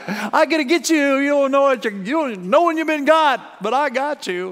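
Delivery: high, loud gloating payback voice; a stewer savouring getting even, gloating; sly revenge voice. Retold or imitated. Preacher voicing a vengeful stewer to the congregation, high sly gloat.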